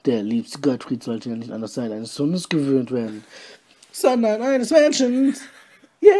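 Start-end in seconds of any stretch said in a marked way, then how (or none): 3.9-6.1 squeaky. A narrator's reading. Narration jumps into squeaky voice.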